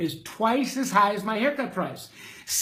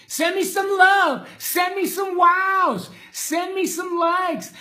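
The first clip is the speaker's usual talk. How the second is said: higher, louder rallying shout; rally the crowd for reactions; hyped shout; 8 semitones higher, 4 dB louder, slower than usual. Real, not acted. Hyping viewers for reactions.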